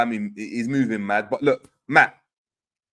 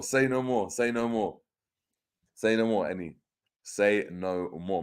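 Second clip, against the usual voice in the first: fading out; 3 semitones lower, 6 dB quieter than usual. Real, not acted. Repeated catchphrase, fading.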